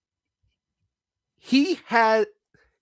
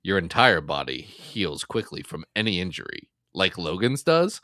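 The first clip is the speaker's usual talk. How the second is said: a lightly lowered barbarian voice, softer; low Conan voice; 8 semitones lower, 4 dB quieter than usual. Retold or imitated. Conan talking to Deadpool in a low, quiet barbarian voice. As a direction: deliver it like a gruff barbarian, dropped low and quiet. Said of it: "is Conan saying to Deadpool".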